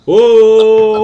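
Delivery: long, high, loud whoa; drawn-out shout of surprise. Real, not acted. Cohost reacting to a risky bet pick, loud whoa.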